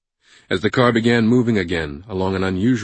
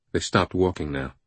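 usual speaking voice

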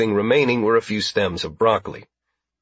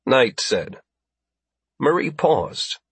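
usual speaking voice

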